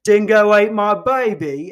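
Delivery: loud, high taunting holler; mocking yell. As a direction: yell it like a cruel punchline. Mocking punchline yelled at Australian woman.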